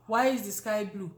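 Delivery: normal talking voice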